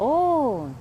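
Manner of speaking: high, slow, playful teasing; imitating Swami. Woman imitating Swami teasing her about getting married. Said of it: "he looks at me and he says".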